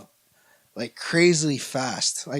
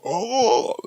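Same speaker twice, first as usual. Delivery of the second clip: mock kissing Oh; 5 semitones higher than usual. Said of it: "girls who go".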